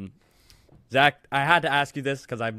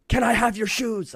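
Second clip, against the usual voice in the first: high, loud, drawn-out shout; slow mock scream, pitched way up; retold scream; 10 semitones higher, 5 dB louder than usual. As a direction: re-enact screaming a request at a player. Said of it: "I just screamed that at him".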